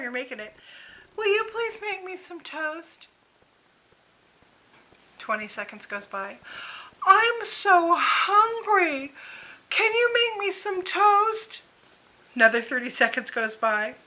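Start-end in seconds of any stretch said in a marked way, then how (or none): 1.2-3.1 put-on higher, sweetly pleading old mother; imitating mother asking. 7.1-11.6 put-on much higher, very slow, drawn-out plaintive old mother; whiny mother. Mother asks again, escalating.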